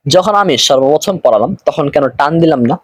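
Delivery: normal talking voice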